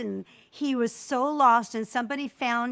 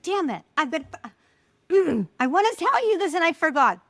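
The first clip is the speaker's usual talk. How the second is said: mock frustration; 7 semitones higher, 6 dB louder than usual. Acting out forgetting mid-sentence.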